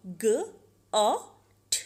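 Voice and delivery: ग, अ, ट as separate "g", short "o", "t"; sounded out. Shown as a demonstration. Teacher spelling out got by sound, ग, अ, ट each isolated. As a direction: break got into ग, अ, ट with long gaps. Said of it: "short sound में example".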